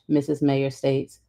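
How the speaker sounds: plain reading voice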